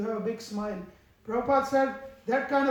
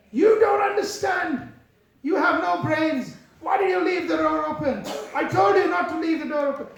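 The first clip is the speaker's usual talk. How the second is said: furious shout; 5 semitones higher, 6 dB louder than usual. Retold or imitated. Devotee shouting at the French lady, furious yell. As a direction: blast her with rage over the open door. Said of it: "he was ballistic".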